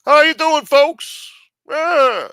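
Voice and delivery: high, loud, friendly politician greeting; Obama voice. Retold or imitated. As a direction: boom out a cheery politician hello. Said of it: "Only place he stopped into".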